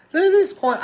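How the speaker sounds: high, loud disbelief at the number; surprised outburst. Real, not acted. Host reading the hockey standings, loud and surprised. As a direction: blurt it out, stunned by what you see.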